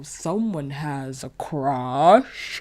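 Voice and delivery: slow, drawn-out tease, rising at the end; audacious teasing. Retold or imitated. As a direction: imitate someone cheekily teasing, stretching each word. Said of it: "had the audacity to go".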